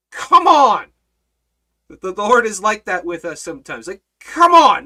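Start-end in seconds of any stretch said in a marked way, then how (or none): 0.1-0.9 loud, drawn-out come on, scolding; exasperated call-out. Acted. Lord scolding disciples. 3.9-4.9 loud scolding come on, quicker; a sharp spiritual smack: come on!; exasperated call-out. Acted. Lord scolding, repeated.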